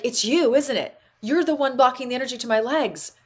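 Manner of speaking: retold accusation. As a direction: call them out with sudden realisation. Retold confrontation of inner self.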